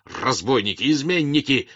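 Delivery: outraged outburst in separate bursts; explode, high and loud on the peaks; beside-himself shouting; frenzied yell. A narrator's reading. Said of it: "не своим голосом завопил Ростов".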